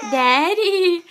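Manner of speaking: laughing praise of the word. Real, not acted. Parent laughing as child signs Daddy. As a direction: laugh it out warmly.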